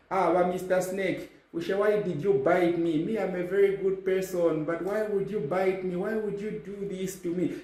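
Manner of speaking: high whiny mock plea; hammed-up wounded pleading; betrayed and heartbroken.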